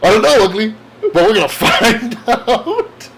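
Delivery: loud, high hero line breaking into laughter; comic-book Spidey. Acted. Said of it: "What, what does he say?".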